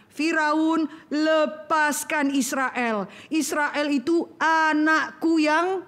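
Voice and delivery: God demanding Israel's release, slow and mighty. Acted. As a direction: command Pharaoh like God himself. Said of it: "Tuhan katakan".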